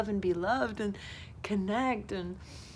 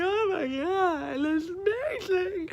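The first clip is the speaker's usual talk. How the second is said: choked-up awe; 9 semitones higher, slower than usual. Retold or imitated. Retold moved reaction to his openness.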